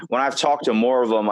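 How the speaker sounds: usual speaking voice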